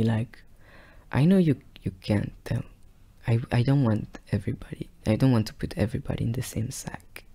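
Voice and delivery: ordinary talk, nothing marked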